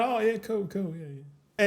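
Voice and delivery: mock-agree to get out of a chat; polite brush-off. Streamer acting out escaping small talk, softly dismissive.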